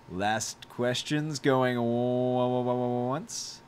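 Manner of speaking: drawn-out auctioneer stammer; auctioneer call. Pretend. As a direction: stutter it out like an auctioneer. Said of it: "auctioneer call, stuttered on purpose".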